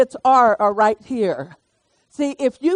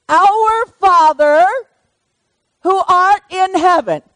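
solemn recitation; 7 semitones higher, 6 dB louder, slower than usual. Acted. Reciting the Lord's Prayer.

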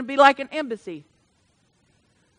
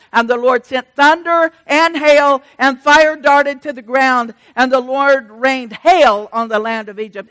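fervent scripture reading; 10 dB louder than usual. Acted.

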